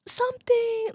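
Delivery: very high, drawn-out call; high sing-out movie quote; Sophie impression. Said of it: "She says it exactly like that".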